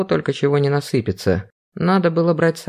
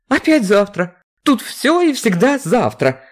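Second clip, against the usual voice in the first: exasperated; 8 semitones higher than usual. Acted. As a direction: rant at the endless waiting. Vera exasperated by delay.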